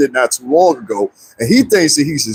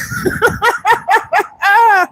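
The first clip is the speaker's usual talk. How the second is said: high, sustained laughter; high-pitched laughing fit; cracking up. Real, not acted. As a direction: fall apart laughing at your own story. Cracking up at retold joke.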